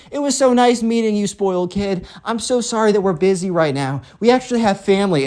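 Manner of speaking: high, sugary farewell; super nice mom. Acted. Mom pushes the kid out the door, super nice. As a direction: gush politely in a high voice. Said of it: "acting super nice while kicking him out".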